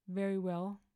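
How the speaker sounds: ordinary delivery, nothing marked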